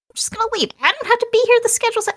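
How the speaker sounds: high-pitched guard voice. Acted. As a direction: squeak it out like a fed-up guard.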